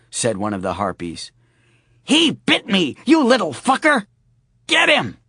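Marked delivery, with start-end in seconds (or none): 2.0-5.2 high, loud furious screech; angry harpy. Acted. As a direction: shriek at the dog. Harpy bitten by dog.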